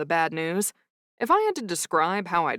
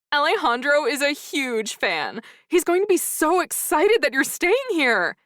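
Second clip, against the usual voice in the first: high, louder gushing fan; excited clerk; 8 semitones higher, 4 dB louder than usual. Acted. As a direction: gush like a starstruck clerk. Starstruck clerk gushes.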